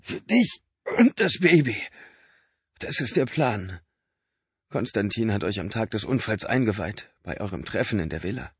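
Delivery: a lightly voiced sick man, pressing his brother; Benny, weak and urgent. A narrator's reading. Benny pressing Mark about the liver, lightly voiced urgency.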